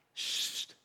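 hushing sst. Retold or imitated. David hushing again.

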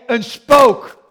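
loud, panicked shout of ghost; terrified cry of ghost on the lake. Pretend. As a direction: yell it like the disciples see a ghost. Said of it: "in paniek".

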